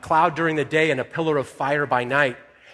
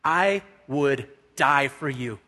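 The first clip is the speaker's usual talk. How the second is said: slow, measured declaration of loyalty; solemn vow; 2 semitones lower, slower than usual. Retold or imitated. Peter proving his loyalty to Jesus, slow and deliberate. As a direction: spell out the vow word by word. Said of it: "to prove his faith and to prove his loyalty".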